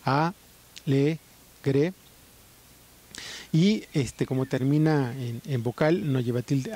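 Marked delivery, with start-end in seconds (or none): 0.0-1.9 alegre broken into a, le, gre with pauses between; syllable by syllable. Shown as a demonstration. Teacher splitting alegre into syllables for the class. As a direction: spell out the syllables one at a time. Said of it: "vamos a separarla en sílabas".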